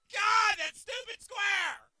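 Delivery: high, loud, slow exasperated yell; real gamer rage; angry shout. Real, not acted. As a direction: shout it in frustration at the game. Angry at the game.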